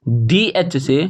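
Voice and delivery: slow chant of spelling, then the letter held long; measured classroom recitation with pauses; spelling drill. Shown as a demonstration. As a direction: land firmly on each drawn-out consonant.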